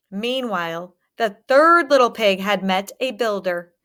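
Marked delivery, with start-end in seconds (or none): none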